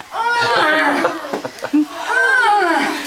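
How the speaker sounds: loud, high effort yell; straining pull cry. Acted. Storyteller pulls at the turnip with children, straining cry. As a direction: heave with a big acted strain.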